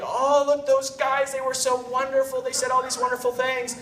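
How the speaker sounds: high, gushing imitation of a wife; mock swooning wife. Husband imitating his wife after the evening, mock swooning. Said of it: "is swooning".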